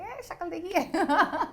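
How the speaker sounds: high, laughing mock scoff; scoffing ridicule. Wife scoffing at husband's acting dream, mocking laugh. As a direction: sneer at his dream through a laugh. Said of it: "I was like".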